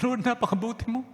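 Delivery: high, breaking voice of thanks; tearful prayer. Real, not acted. Pastor thanking God through tears, voice breaking high. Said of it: "Umiyak ako ng lubos".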